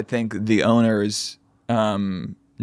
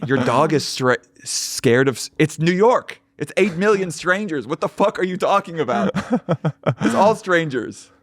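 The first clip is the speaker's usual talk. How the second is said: retold indignation; 6 semitones higher than usual. Retelling own angry reply.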